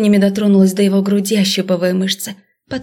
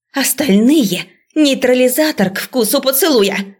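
высокая тревожная команда; раздай указание срочно, с напором; приказ в панике; 5 semitones higher than usual. A narrator's reading. Преподавательница командует остальным. (взволнованный окрик преподавательницы)

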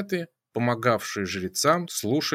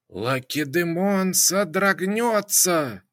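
slow, raised hissed prophecy; hissing; 6 semitones higher, slower than usual. A narrator's reading. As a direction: hiss it out slowly. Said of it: "прошипела Пифия".

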